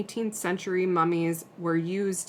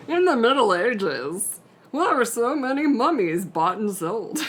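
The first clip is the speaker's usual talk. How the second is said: slow, high, loud Muppet announcer; 4 semitones higher, 6 dB louder than usual. Acted. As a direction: go full Muppet on the question. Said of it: "You just go full Muppet".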